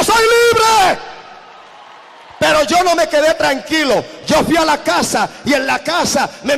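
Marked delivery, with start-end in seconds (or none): 0.0-1.0 high, loud joyful cry; loud high shout of release; shouted cry of freedom. Retold or imitated. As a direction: burst out as if released. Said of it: "Se desplomó y gritó".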